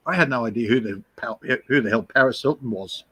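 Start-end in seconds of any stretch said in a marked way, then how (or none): none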